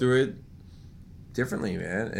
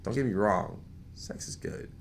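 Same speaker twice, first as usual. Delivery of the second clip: low confiding voice; 8 semitones lower, 5 dB quieter than usual. Retold or imitated. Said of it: "you're just like".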